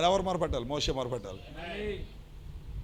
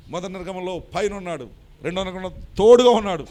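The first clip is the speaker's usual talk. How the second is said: ordinary talk, nothing marked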